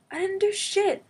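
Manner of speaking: high and loud indignant outburst; indignant protest. Retold or imitated. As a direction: fire it back, high and hot. Retold protest of innocence.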